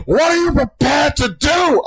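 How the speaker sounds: high, slow, desperate shout; gasping shout. Retold or imitated. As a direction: yell it like a dying man pleading.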